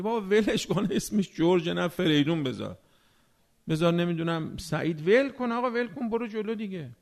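dismissive brush-off. Retold or imitated. Preacher mimics a novel reader brushing off a name, loud and dismissive. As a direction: shrug it all off, loud and impatient.

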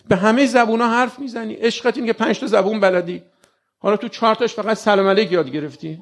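mock the one who won't recite; preacher teasing the congregation sarcastically; mocking rebuke. Real, not acted. Mocking the lazy listener.

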